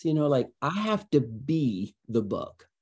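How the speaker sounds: ordinary talk, nothing marked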